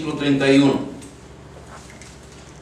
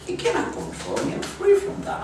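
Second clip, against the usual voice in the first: high airy declaration; 5 dB quieter than usual. Retold or imitated. Preacher declaring Satan cannot control her, high and breathy.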